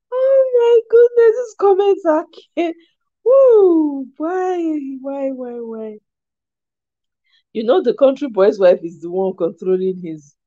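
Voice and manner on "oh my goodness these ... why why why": very high, laughing wail that sinks away; helpless laughter. Real, not acted. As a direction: laugh till it sounds like crying, then fade. Overcome with laughter at the comments.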